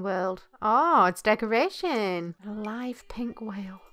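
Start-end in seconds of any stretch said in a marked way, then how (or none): 2.5-3.8 slow, very soft whisper. Real, not acted. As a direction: breathe the words out slowly under your breath. Item name repeated in whisper.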